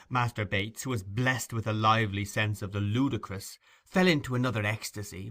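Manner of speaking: plain reading voice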